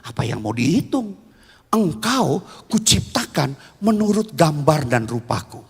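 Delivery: low, measured divine pronouncement; God. Acted. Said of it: "Tuhan bilang begini".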